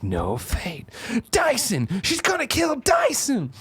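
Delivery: dawning panic, drawn out; alarmed realization. Retold or imitated. John realizes his mother's plan, slow and alarmed. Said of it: "Or he's like".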